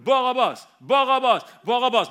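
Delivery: very loud, high mob chant; full-force imitation of a chanting mob; crowd shouting. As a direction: bellow the name like a whole crowd. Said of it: "Han hadde just hørt".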